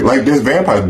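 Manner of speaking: normal talking voice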